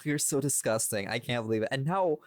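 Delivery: ordinary delivery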